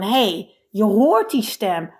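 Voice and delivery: ordinary talk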